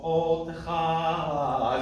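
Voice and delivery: "Otecha" sung very slow with a strong accent on the O; heavy O. Shown as a demonstration. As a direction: chant "Otecha", stressing the O hard. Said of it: "one could go".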